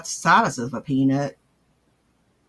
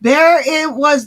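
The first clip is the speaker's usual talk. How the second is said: loud outburst; 8 semitones higher, 9 dB louder, slower than usual. Real, not acted. Streamer reacting, loud outburst.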